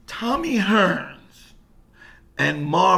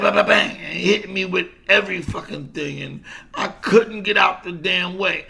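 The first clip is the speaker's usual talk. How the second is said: ordinary talk, nothing marked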